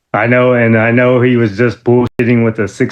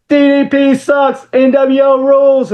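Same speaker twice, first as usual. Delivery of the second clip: much higher, slow taunting yell; mock yell from car. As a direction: holler the taunt from a passing car. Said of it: "he yells out".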